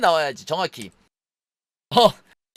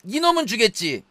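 normal talking voice

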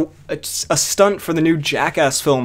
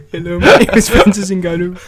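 mock French accent, higher and louder; raised, comic French-accented voice; Francis Ngannou; 4 semitones higher, 5 dB louder than usual. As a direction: introduce yourself in a hammed-up French accent. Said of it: "French accent is just".

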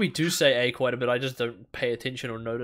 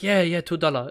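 put-on higher, caricatured Chinese-accented shopkeeper; mock Chinese accent; 4 semitones higher, 4 dB louder than usual. Shopkeeper confirming the price to a customer, mock Chinese accent. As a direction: play the agreeing seller in imitated Chinese English. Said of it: "like, a Chinese".

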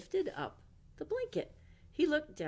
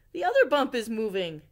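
loud, laughing excited cry; 4 semitones higher, 10 dB louder, faster than usual. Acted. Owl watching the second bump move, loud and laughing. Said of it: "cried Owl".

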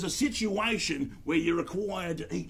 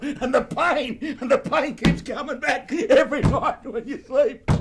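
laughing while talking; 6 semitones higher, 6 dB louder than usual. Real, not acted. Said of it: "laughing through the story".

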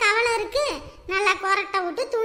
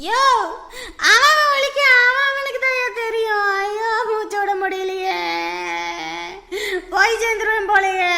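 wailing in pain; 4 dB louder than usual. Acted. Patient protesting that it hurts, wailing loudly. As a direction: bawl as if about to die. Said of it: "இப்படியே கத்திக்கிட்டே இருந்தீங்கன்னா".

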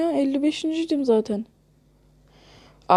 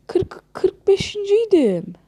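whiny lament; 8 semitones higher, slower than usual. Real, not acted. Lamenting losing rank.

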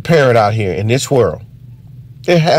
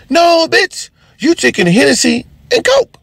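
mock correction; 14 semitones higher than usual. Correcting the friend on his drink, high scolding. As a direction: snap the correction like a know-it-all.

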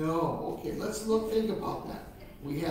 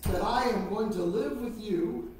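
sweet patient sunday school; 2 semitones higher than usual. Acted. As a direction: lead the kids in prayer phrase by phrase. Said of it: "repeat after me".

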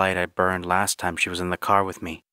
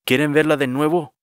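higher, louder Spanish question; insistent questioning; 7 semitones higher, 5 dB louder than usual. Acted. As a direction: urge them, voice raised. Johnny offers the photo again, pressing.

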